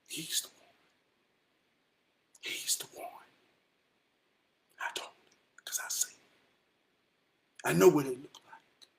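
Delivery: near-whispered, very soft and low; hushed reflection. Real, not acted. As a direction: let it out under the breath. Hushed storytelling aside.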